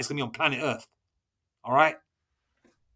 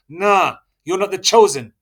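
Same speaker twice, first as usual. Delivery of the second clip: loud, raised preaching rebuke; 4 semitones higher, 8 dB louder, slower than usual. Acted. Preacher loudly tells outsiders they are not the chosen. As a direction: tell the outsiders off, loud and higher.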